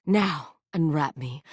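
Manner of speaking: ordinary talk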